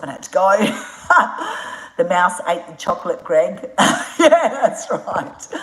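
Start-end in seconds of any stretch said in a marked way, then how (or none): 3.7-5.5 laughing. Real, not acted. Laughing at the chat comment.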